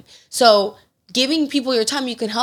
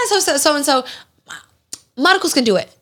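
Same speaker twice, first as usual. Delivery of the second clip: higher-pitched, breezy mom imitation; airy, eager agreement, mimicked; breezy volunteering; 5 semitones higher, faster than usual. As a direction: offer him up without a second thought. Mom casually volunteering dad.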